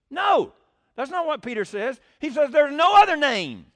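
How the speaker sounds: loud, high preaching rebuttal of NO OTHER NAME; emphatic rebuttal. Real, not acted. Preacher rebutting false teachers, forceful and loud. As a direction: hammer home that it's no other name.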